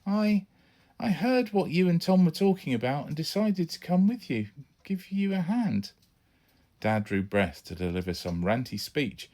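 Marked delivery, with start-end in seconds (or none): 0.1-5.9 put-on boy's voice. Son explains himself to dad.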